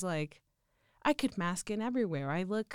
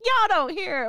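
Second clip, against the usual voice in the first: playful mock Texan accent, "Y'all" pitched up high; 10 semitones higher, 6 dB louder than usual. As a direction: show off your Texan accent on "Y'all don't hear it", a lot higher. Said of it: "And I sound so Texan being like".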